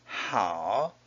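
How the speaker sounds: "好" sinking low and turning up; third tone. Shown as a demonstration. Teacher giving the word for good, low dipping third tone. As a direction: dip down low on "好". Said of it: "好 is a third tone".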